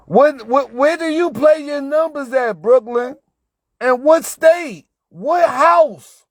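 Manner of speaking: high, loud, slow imitation of pestering viewers; mock pestering yell; exasperated mock questions.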